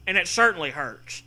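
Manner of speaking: usual speaking voice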